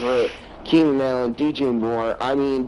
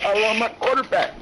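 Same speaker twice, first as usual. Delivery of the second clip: high, hammed-up sobby plea; mock crying; 8 semitones higher than usual. Player sitting crying on stage, mock crying. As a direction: put on a whiny, tearful high voice. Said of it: "crying on stage being like".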